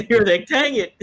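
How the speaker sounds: laughing mock disappointment; giggly high "dang it".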